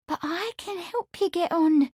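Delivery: put-on high, urgent young woman's plea; pleading. A narrator's reading. Amelia cried to Lawrence, pleading.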